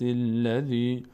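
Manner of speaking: «الذی» chanted as "silladhi", its first vowel dropped; joined to previous word. Shown as a demonstration. Teacher showing joined verses, «الذی» slurred on without initial "a". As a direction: run «الذی» on from the word before, long final vowel. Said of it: "اگر خواستیم اینا رو به هم بچسبونیم".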